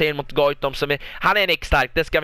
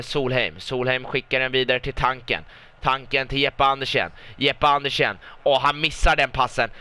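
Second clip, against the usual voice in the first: ordinary talk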